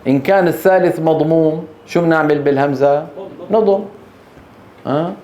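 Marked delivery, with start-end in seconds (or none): none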